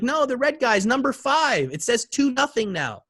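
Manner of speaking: put-on high-pitched child's correction; insistent little girl. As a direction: explain it like an earnest small kid. Daughter reporting the score.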